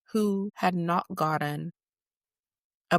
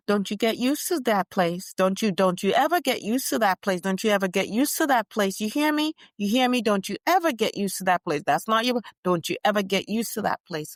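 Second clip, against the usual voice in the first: louder, forceful repeated warning; insistent grandmother; 5 semitones higher, 6 dB louder than usual. Retold or imitated. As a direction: drive it home like a stern grandma. Grandmother urging on the phone.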